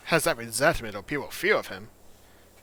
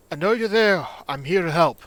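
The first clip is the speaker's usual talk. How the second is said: raised, louder call to someone hidden; 6 semitones higher, 10 dB louder than usual. Acted. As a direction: call out to coax them from hiding. Calling to a hidden child.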